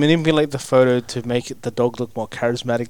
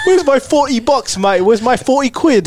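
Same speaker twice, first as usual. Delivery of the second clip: louder, higher mock British geezer accent; British accent, raised and laughing; 11 semitones higher, 6 dB louder than usual. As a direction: play a British bloke chasing a debt. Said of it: "why are you going British?".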